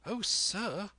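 put-on high, eager boyish cry. A narrator's reading. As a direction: burst out like an impatient boy. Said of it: "cried I".

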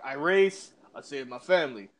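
lightly voiced action-hero impression; low-key tough guy listing his deeds; Dominic Toretto. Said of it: "Is that your Dominic Toretto voice?".

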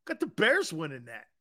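ordinary talk

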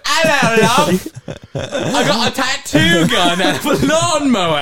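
high, slow British bloke, laughing; laughing through a British accent. Retold or imitated. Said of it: "He sounds so different when Robert takes over".

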